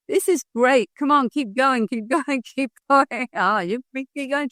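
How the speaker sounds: high, delighted cheering; excited egging-on. Retold or imitated. Husband cheering on his yelling wife, high excited encouragement. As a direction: urge them on in a high, gleeful voice.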